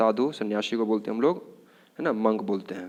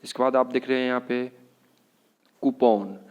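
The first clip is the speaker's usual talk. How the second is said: ordinary talk, nothing marked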